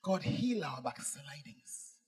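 slow, breathy whisper to God; whispered prayer. Real, not acted. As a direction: pray it slowly in a hush. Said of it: "This is my prayer".

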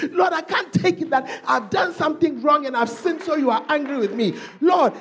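high, urgent prayerful plea; desperate pleading. Acted. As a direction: beg the Lord for a single word. Acted desperate plea to God.

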